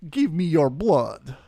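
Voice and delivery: slow, spooky vampire voice; lightly voiced Dracula, drawn out. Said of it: "sounded like Dracula of my Russian accent".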